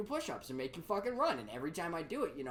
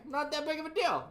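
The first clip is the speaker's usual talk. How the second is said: ordinary delivery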